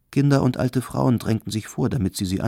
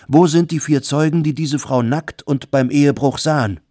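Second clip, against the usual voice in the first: higher, louder repeated question; demanding shout; 5 semitones higher, 6 dB louder than usual. A narrator's reading. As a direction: press the crowd, escalating.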